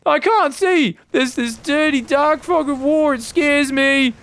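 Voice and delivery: put-on high, slow, babyish fear; imitating a timid player's scared whine; mock scared whimper. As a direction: whine about the dark like a scared kid. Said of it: "Are you afraid of the map?".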